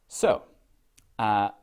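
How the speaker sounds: ordinary talk, nothing marked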